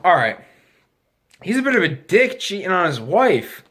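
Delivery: ordinary delivery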